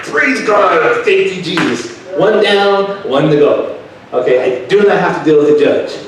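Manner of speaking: high, loud burst of praise; joyful shout. Real, not acted. Church testimony, praising God aloud in relief. Relieved after skipping the judge.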